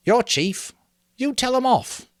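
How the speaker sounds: high, loud small prompt; shy boy's voice. A narrator's reading. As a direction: nudge the chief to act. Simon voiced.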